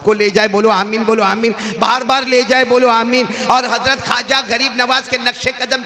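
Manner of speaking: high, ringing sermon voice held on every line; full-force preaching. Acted. Preacher driving home the Prophet's words at full force. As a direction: preach it out to the whole hall.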